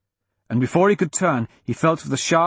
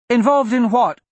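put-on high, louder child's question; young girl; 10 semitones higher, 5 dB louder than usual. A narrator's reading. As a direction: demand an answer as a little girl. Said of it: "Ally asked".

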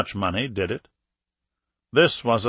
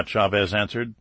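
ordinary delivery, nothing marked

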